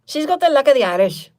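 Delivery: loud, high, hammed-up Irish brogue; attempted Irish accent. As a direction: have a big second go at an Irish accent. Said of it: "what the hell is an Irish sound?".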